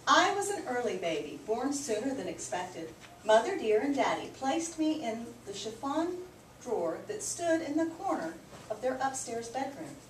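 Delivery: usual speaking voice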